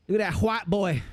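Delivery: put-on a Floridian saying "hwite boy"; Floridian accent. Imitating Floridians, Floridian accent with hw. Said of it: "how Floridians have the H".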